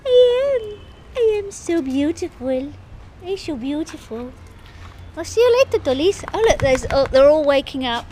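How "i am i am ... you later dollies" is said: very high, cutesy pretend dog voice; voicing the dog. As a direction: squeak it out as the little dog Dolly, preening. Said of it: "You're saying hello".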